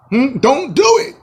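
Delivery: high-pitched plea, rising at the end; high pleading. Pretend. Pleading with Kevin Durant not to sign, high voice. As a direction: beg him in a high voice. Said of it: "pleading with Durant".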